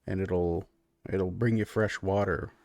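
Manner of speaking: low, soft, halting country man; easygoing furbolg host. Acted. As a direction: amble through it, low and quiet. Game master plays Clyde.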